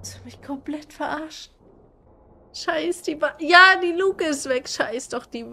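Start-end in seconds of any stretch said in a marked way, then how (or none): 0.1-1.5 whispered, much softer. Real, not acted. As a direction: hiss it under the breath. Whispered in a tense moment. (geflüstert)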